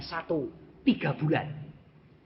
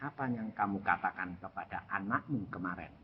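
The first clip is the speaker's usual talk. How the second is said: low, soft, grave questioning; voice of God; 6 semitones lower, 9 dB quieter than usual. Retold or imitated. God asking father about yesterday, low and quiet. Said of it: "Tuhan mengatakan".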